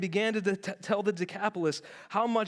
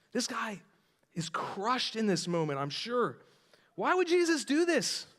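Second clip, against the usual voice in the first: ordinary talk, nothing marked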